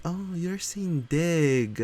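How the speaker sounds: high, slow, drawn-out "oh, I see" tone; mock realisation. Streamer mimicking commenters finally understanding dig site, mock realisation. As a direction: stretch it out as exaggerated dawning understanding.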